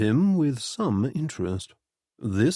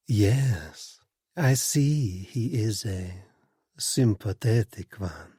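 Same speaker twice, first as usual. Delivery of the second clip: smooth as silk; slower than usual. A narrator's reading. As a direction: purr it like a seductive hostess. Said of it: "in a voice that was as smooth as silk".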